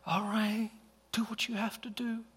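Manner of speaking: small, high, softened voice; imitating a deflated teenager; resigned daughter. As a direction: give in quietly, pitched high. Daughter gives in to her father.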